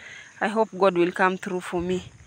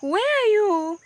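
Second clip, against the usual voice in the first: high eager question; 10 semitones higher than usual. Pretend. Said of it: "I'll be like".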